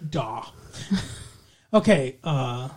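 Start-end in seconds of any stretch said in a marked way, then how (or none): 0.1-0.4 put-on low, soft word in a Scottish accent; Scottish actor's deadpan low reply; Sean Connery. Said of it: "Sean Connery does that accent in every".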